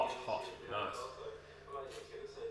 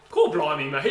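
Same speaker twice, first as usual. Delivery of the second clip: "Cool, blimey" said louder in a mock English accent; mocking Pommy accent; 3 semitones higher, 10 dB louder than usual. Teasing his cohost's bad English accent, louder mock Pommy accent. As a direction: echo the friend's bad British accent back at him. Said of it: "Is that what you said?".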